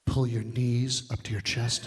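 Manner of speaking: slow, low, hushed doctor; creepy quiet doctor. Retold or imitated. Doctor giving orders, slow low creepy voice. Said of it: "he says it kinda quietly".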